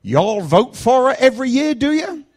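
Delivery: high, loud Alabama questioning; mock Alabama accent. Driver asking about London, loud high Alabama accent. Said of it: "This accent is a Vaughnabama accent".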